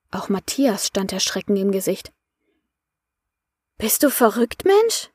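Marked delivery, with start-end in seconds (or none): 3.8-5.1 alarmed disbelief; aghast. A narrator's reading. Mirabella reacts to Andreas wanting death, aghast. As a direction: question jumping much higher on Mensch. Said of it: "fragte sie".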